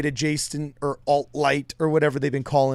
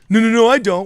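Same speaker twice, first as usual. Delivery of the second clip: high, loud protest; loud whiny denial; 6 semitones higher, 9 dB louder than usual. Retold or imitated. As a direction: protest it shrilly like a kid. Flustered denial in bully scene.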